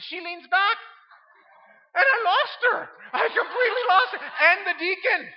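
high, loud, laughing through the telling; barely getting it out for laughter; telling the church how he lost her, laughing. Real, not acted. Laughing retelling the mishap.

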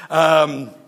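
ordinary talk

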